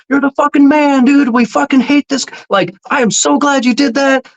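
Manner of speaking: high, gushing imitation of the camera guys; excited crew. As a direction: pitch it way up, thrilled. Crew praising him, retold.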